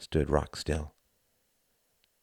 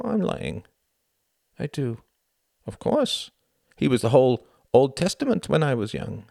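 a hint of a proud young German; earnest, a touch higher; lightly voiced Klaus; 9 semitones higher than usual. A narrator's reading. Klaus confiding in Tom on the walk, earnest.

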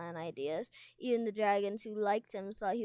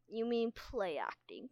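usual speaking voice

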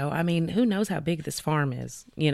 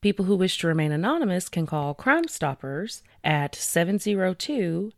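ordinary talk, nothing marked

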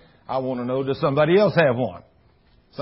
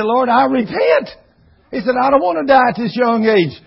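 pleading repentance; 8 semitones higher, 6 dB louder than usual. Retold or imitated. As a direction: beg for his life. Man begs forgiveness.

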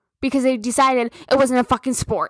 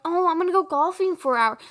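mocking boyfriend; 6 semitones higher, much faster than usual. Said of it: "it wasn't like".

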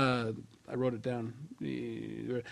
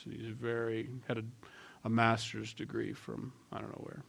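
stoic grandfather; 3 semitones lower, 10 dB quieter than usual. Retold or imitated. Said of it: "This is what he talked like this".

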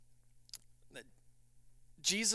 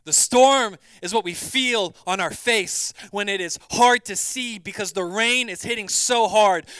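louder, higher, driving the storm image home; full-force preaching; 7 semitones higher, 12 dB louder than usual. Acted. Pastor urging the congregation through life's storms, full-force preaching. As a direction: preach it hard, lifting the room.

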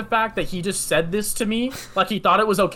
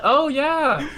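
high-pitched, exaggerated polite agreement; humouring agreement; 8 semitones higher than usual. Driver humouring the man-cave owner, exaggerated agreement. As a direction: play along a little too eagerly.

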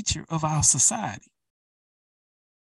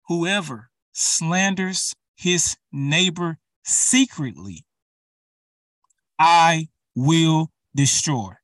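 scripture reading; 4 dB louder than usual. Acted. Reading Psalm one oh one.

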